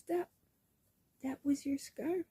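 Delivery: high, soft, astonished client; imitating disbelief. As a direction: act out a hushed protest. Client's retold disbelief at gift.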